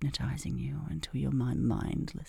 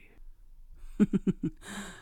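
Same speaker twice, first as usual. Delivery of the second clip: breathy giggle; 6 semitones higher than usual. Acted. Teasing hypnosis roleplay.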